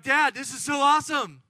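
high, loud gushing to Dad; excited daughter. Acted. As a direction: gush like an overexcited kid back from camp. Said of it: "they're so excited".

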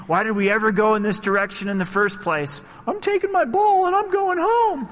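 high, loud exasperated whine breaking into a laugh; frustrated quitting. Retold or imitated. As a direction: throw up your hands and quit, squeaky and loud. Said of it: "but it's like".

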